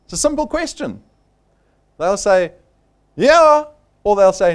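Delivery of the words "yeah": higher, louder eager yes; a keen prospect agreeing; prospect saying yes. Retold or imitated. Recruiter imitating a prospect answering, louder and higher.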